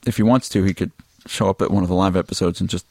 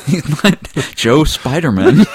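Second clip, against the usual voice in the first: laughing; 9 semitones higher, slower than usual. Real, not acted. Laughing, slow.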